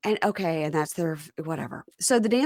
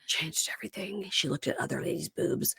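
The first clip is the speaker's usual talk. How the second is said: much softer, dropping into a whisper; hushed mock scandal; 2 semitones lower, 11 dB quieter than usual. Echoing co-host about the nude painting, hushed mock scandal. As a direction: whisper it like a shocking secret.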